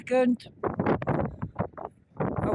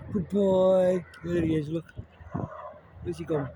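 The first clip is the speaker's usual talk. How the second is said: ordinary talk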